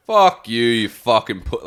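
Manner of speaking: loud, high mock curse; mock shout. Friend imagining the parting insult, loud and high. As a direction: yell it at his back as a joke. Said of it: "you were like".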